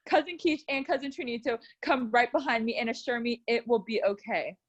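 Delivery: usual speaking voice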